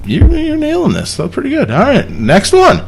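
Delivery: ordinary delivery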